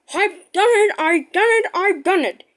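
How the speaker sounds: proud. Acted. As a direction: crow with pride. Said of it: "he said proudly".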